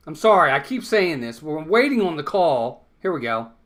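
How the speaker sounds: ordinary talk, nothing marked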